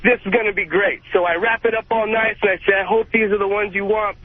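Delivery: normal talking voice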